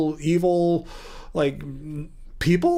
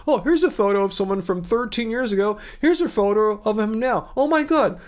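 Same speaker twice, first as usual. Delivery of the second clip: higher-pitched mock gushing, rising further at the end; mock amazement; 5 semitones higher than usual. Streamer parodying anti-vegan content about aging, mock amazed. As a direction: imitate an overexcited video maker, pitched up.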